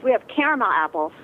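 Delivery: ordinary talk, nothing marked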